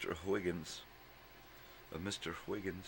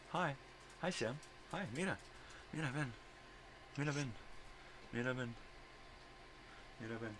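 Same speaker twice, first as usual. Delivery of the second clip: sweet coaxing; 7 semitones higher, 7 dB quieter, faster than usual. Real, not acted. Owner sweetly coaxing her dog to come, high and soft. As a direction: coax the dog in a sing-song.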